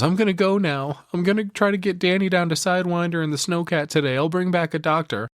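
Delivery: a touch of put-on sobbing resolve; imitating tearful Wendy. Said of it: "All of which she says through tears and sobs".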